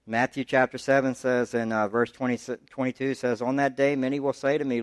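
usual speaking voice

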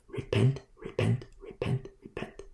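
repent repeated, dropping from soft to a whisper; fading to whisper. Real, not acted. Preacher pleading with sinners, fading to a whisper. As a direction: let each repent fade until only breath is left.

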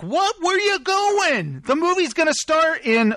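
high-pitched, incredulous question; mock alarm. Husband to wife leaving before the movie, mock alarm.

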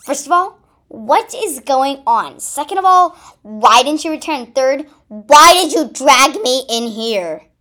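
loud, high, exasperated questioning; confused. A narrator's reading. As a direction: demand answers in loud confusion. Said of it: "Pink Ruby said confusedly".

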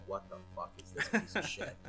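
usual speaking voice

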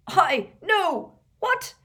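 high, loud, flustered splutter. A narrator's reading. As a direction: splutter in broken protest. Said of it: "spluttered Mundungus".

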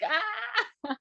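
high, restrained mock scream. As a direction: let out a small pretend scream, high-pitched. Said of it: "Screams and boiling milk, like".

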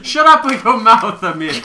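loud, laughing misheard line; laughing mock shout. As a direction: belt it out through laughter. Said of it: "I actually thought it was".

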